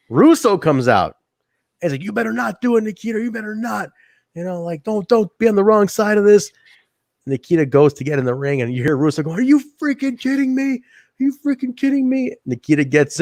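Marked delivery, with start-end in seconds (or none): 2.0-6.5 higher-pitched retold plea; acted-out insistent warning; urgent warning. Retelling wrestler warning Nikita. 9.3-12.3 much higher imitated voice; retold incredulous protest; mock outrage. Retelling Russo's outraged reaction.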